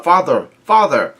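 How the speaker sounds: mild mock cry of alarm; retold yell. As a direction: call out twice, each falling away. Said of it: "both yell out".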